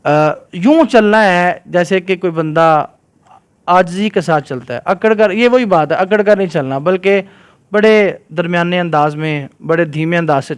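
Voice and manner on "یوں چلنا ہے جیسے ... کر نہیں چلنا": usual speaking voice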